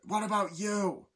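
hammed-up agent yelling; mock interrogation. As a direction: turn on the next prisoner. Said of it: "He's like".

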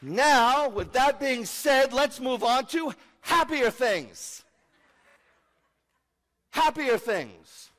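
high, loud, drawn-out proclamation; loud announcement. Real, not acted. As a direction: belt it out slowly to change the subject. Loudly announces change of subject.

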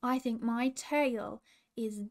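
ordinary delivery

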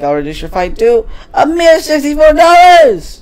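high, loud, far-from-natural announcer voice; over-the-top librarian proclamation. Acted. Library assistant announcing a reduced fine, high grand proclamation. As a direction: build to a hammed-up big reveal.

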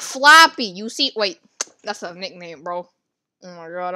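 ordinary talk

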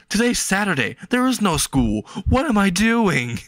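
panicked realization. Acted. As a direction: pitch up in a dawning panic.

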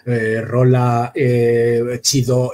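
slow, drawn-out Mexican slang in a put-on Mexican accent; exaggerated Mexican Spanish, slowly; mock Mexican accent. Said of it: "lo rapeó en mexicano".

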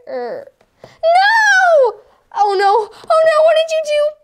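high dismayed wail; crying out. Acted. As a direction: wail at the mess. Dismayed character cries out.

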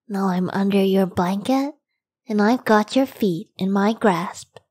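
ordinary talk

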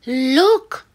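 loud, high exclamation; loud excited storybook exclaim; excited look. Shown as a demonstration. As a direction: call it out brightly. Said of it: "reading story aloud".

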